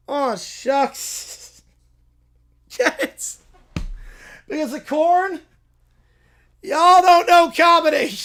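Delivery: hammed-up corny catchphrase. Farmhand cracking his corn joke, hammy and loud. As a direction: sell the corn pun like a hayseed comic.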